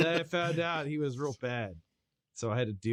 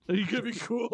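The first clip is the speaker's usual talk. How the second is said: laughing; 9 semitones higher, faster than usual. Retold or imitated. Repeats the bugbear line laughing.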